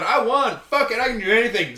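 high and loud, reckless glee; giddy winner. Retold or imitated. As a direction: shout it like nothing can stop you. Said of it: "S0 picks up the voice".

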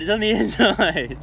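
laughing repeat of the same line to the cleaner. Retold or imitated. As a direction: repeat it while cracking up. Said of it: "and I'm like".

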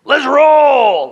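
high, loud rallying shout; mock battle cry. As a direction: shout it out like you're leading a charge. Voicing thieves, sudden battle cry.

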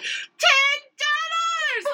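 high, drawn-out mock shout; hammed-up angry street vendor; angry vendor impression. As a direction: stretch the price out like an angry food truck guy. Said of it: "he would be like".

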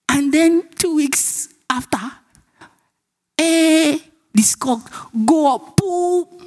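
high, drawn-out storyteller suspense; slow high-pitched build to the payoff; gleeful build-up. Acted. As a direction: act out the big reveal, high and savoured. Dramatizing the stock's sudden rise.

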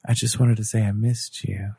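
breathy seductive ASMR whisper; teasing fake-sexy whisper; mock sexy whisper.

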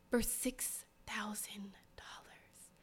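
slow, awed whisper. Real, not acted. Whispering the sale price to viewers.

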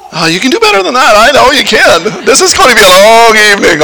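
normal talking voice

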